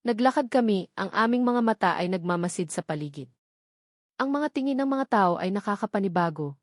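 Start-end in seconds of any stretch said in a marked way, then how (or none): none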